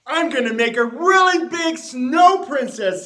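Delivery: high, loud, eager little voice; excited boast. A narrator's reading. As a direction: brag to everyone about your plan. Penelope boasting about her snowman.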